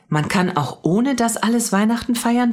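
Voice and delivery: put-on high, astonished child's question; wide-eyed girl working it out; amazed. A narrator's reading. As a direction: pitch shoots up in disbelief. Mia amazed at the revelation.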